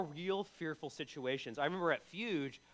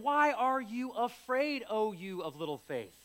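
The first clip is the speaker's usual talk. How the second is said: raised, slowed voice of Jesus questioning; Jesus asking gently; 5 semitones higher, 4 dB louder than usual. Retold or imitated. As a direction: let each word of the question land slowly. Jesus quoted rebuking disciples.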